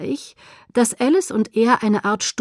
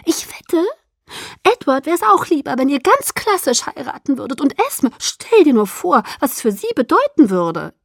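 high, insistent coaxing; pressing, animated argument; eager persuasion; 7 semitones higher, 5 dB louder than usual. A narrator's reading. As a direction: coax in a high, forceful voice. Alice coaxing her reluctant friend.

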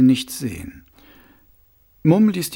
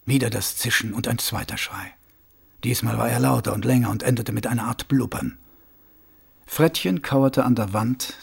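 usual speaking voice

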